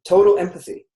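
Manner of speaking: ordinary talk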